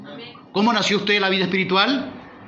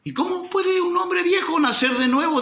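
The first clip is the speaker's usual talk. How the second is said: 1 skeptical Nicodemo; 14 semitones higher than usual. Acted. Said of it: "dijo Nicodemo".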